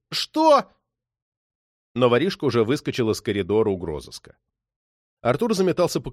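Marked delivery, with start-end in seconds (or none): 0.1-0.7 loud, very high shout; outraged one-word demand; threatening challenge. A narrator's reading. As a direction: bark it after the departing thief. Cop menaces the departing thief.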